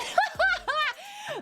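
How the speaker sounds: high-pitched burst of laughter. Real, not acted. Streamer amused by her own swooning, high laugh.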